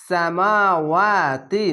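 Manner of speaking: "সামাওয়াতি" chanted with long মা and ওয়া; very slow recitation of "সামাওয়াতি", vowels held; one-alif elongation. Shown as a demonstration. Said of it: "খার জবর এক আলিফ টেনে পড়বেন".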